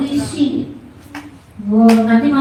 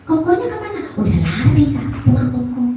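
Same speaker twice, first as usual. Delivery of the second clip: ordinary delivery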